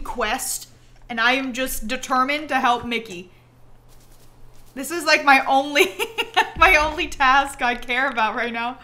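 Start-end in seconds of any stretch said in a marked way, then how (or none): none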